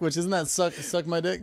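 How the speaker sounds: ordinary delivery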